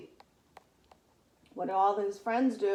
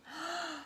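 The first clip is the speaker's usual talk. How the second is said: gasp; 5 semitones higher, 8 dB quieter than usual. Acted. Surprised moment in story.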